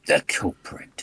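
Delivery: hushed accusing whisper. Acted. Player naming the suspect at the table, whispered. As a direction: breathe it out as a secret.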